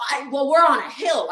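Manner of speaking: put-on defensive whine; mock blaming protest. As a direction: mimic a hiker making excuses loudly. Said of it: "this person starts blaming".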